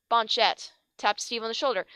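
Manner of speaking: ordinary delivery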